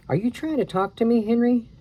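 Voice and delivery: Annie asks in a high, eager voice; high little-girl Annie. Acted. Girl asking the pteranodon, high and curious. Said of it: "asked Annie".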